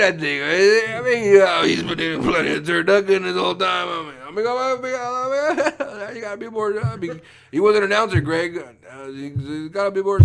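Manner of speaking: lightly laughing Madden bit; John Madden impression. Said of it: "I'm doing a Frank Caliendo doing a".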